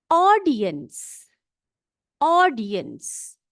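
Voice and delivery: ऑडियंस said slowly, syllable by syllable, aw-di-yans; drawn out ऑडियंस, each sound clear for the class; slow careful pronunciation. Shown as a demonstration. Teacher to pupils, sounding out ऑडियंस slowly. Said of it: "देखो कैसे पढ़ रही हूं मैं इसको".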